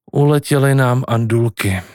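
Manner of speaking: ordinary delivery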